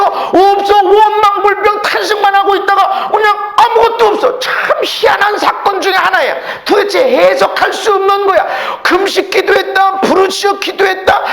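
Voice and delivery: full-force, high-pitched preaching. Acted. Preacher retelling neighbors' blame of the blind man, impassioned preaching. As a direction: drive the message home loudly, hardly letting up.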